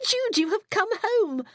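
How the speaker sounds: high, loud, overjoyed cry; joyful cry. Acted. Sister welcoming Judith home, crying out joyfully. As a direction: burst out with delight at her return. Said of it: "she cried".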